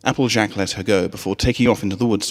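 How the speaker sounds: plain reading voice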